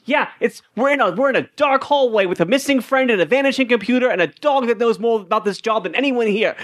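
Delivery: high-pitched, insistent encouragement; rousing pep talk. Acted. Doctor pushes Larry to believe in himself, rousing. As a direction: urge him on with building enthusiasm.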